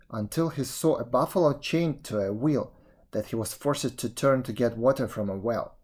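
ordinary delivery, nothing marked

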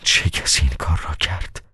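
soft, hushed question; a whisper of disbelief, barely voiced. A narrator's reading. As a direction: breathe it out in a stunned whisper. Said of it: "باجگیر دوباره به زمزمه گفت".

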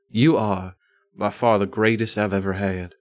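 usual speaking voice

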